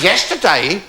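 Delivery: high, rising disbelief; incredulous echo. Retold or imitated. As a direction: echo it as an astonished question. Counsellor repeats her answer in disbelief.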